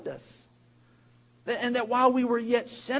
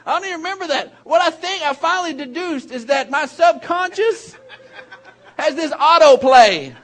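chuckling through the words; laughing at his own habit; laughing while telling; 7 semitones higher, 12 dB louder than usual. Real, not acted. Pastor laughing at himself to the congregation, laughing.

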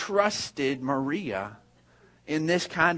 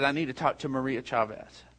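low, subdued retelling of tears; understated, soft and low plea; retold tearful plea; 4 semitones lower, 7 dB quieter than usual. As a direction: quietly voice a distressed young mother, without sobbing. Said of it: "she's just crying".

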